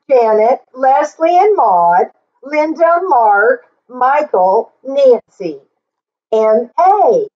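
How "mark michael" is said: plain reading voice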